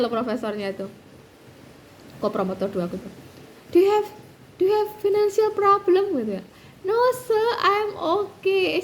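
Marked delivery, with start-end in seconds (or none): 3.7-6.1 higher, louder worried questioning; a hint of the anxious professor pressing; concerned professor. Promotor two pressing her about money, mildly worried. 6.8-8.8 high, very slow mild choked I'm okay; tearful denial. Retold or imitated. Insisting to the professor she is fine, mildly tearful.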